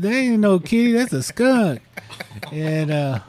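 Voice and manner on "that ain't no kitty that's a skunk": put-on higher boyfriend insisting; mock retort. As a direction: insist it's a skunk, high and emphatic. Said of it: "He said".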